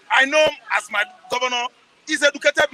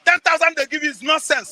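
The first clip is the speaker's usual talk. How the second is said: usual speaking voice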